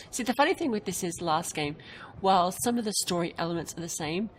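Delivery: usual speaking voice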